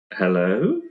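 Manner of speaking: ordinary delivery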